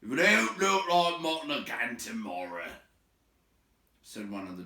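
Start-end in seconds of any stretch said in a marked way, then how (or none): 0.0-2.7 rough troll voice, loud and slow on mutton; a sulky troll complaining about supper; grumbling troll. Acted. A troll moaning at his mates about mutton again.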